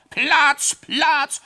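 very high, loud bellow clearing the way; roaring shout. Acted. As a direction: yell it at the top of your lungs. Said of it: "brüllte er dabei in einem fort".

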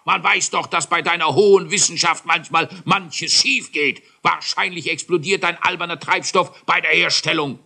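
high, loud furious royal tirade; bellowing king's fury; thundering king. Acted. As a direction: rage at the foolish scientist. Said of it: "er donnerte".

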